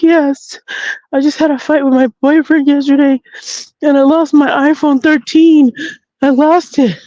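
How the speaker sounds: high-pitched mock-weepy young woman; imitated tearful woman. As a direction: whine it as if upset and crying. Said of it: "she's, she's crying".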